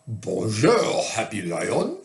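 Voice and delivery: put-on French schoolmaster greeting; a hint of a French schoolmaster; lightly voiced French schoolmaster. A narrator's reading. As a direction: greet the lion with a rising lilt at the end. Voicing schoolmaster's greeting in story.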